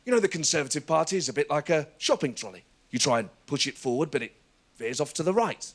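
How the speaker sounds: a light take on a halting, pause-filled speaker; understated Clegg joke with deliberate pauses; lightly voiced politician telling a naff joke; Nick Clegg impression. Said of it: "He went".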